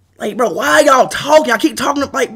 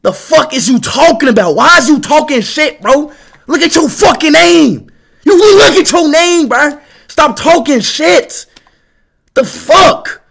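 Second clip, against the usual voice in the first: higher, louder angry ranting; heated rant; 5 semitones higher, 5 dB louder than usual. Real, not acted. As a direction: tell the chatter off, heated. Angry at chatters insulting him.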